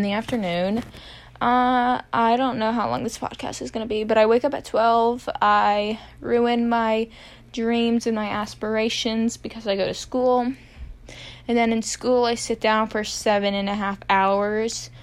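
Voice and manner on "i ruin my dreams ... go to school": ordinary talk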